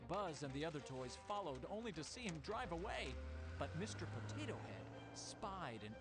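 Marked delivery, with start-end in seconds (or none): none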